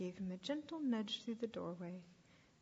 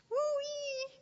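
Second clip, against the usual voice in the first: very high, loud cheer; drawn-out high holler of glee; gleeful whoop; 6 dB louder than usual. Acted. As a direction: whoop it out in jubilation. Runaway sheep celebrates escape.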